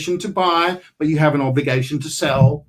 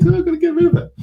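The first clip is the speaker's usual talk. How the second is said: panicked seller; 4 dB louder, much faster than usual. Retold or imitated.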